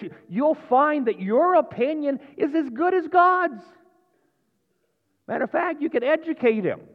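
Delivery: high, loud, mock-indignant argument; scoffing tempter. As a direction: argue like the tempter, pitched well up. Voices the tempter's third lie.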